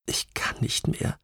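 voiceless, exhausted whisper; whispered, worn out. A narrator's reading. Witness at her limit under questioning, whispered. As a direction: whisper it as if giving up. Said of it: "flüstert sie".

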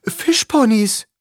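high, loud, drawn-out exclamation; childlike delight, slow and high; amazed cry. A narrator's reading. Tyson spotting sea horses in the waves, amazed.

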